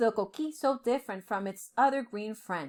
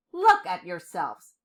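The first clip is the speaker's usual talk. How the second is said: disgusted; 4 semitones lower, 6 dB louder than usual. A narrator's reading. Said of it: "he said with disgust".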